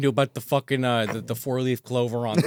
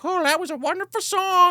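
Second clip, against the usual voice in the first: put-on much higher, gushing girl's voice; sweet Katie. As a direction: gush like a smitten young woman. Katie praising his singing.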